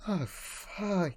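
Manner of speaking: high, overwhelmed sigh of a curse; teary. Real, not acted. Gamer shaken by the ending, tearful. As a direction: curse weakly through welling tears.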